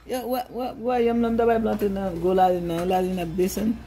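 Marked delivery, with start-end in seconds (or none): none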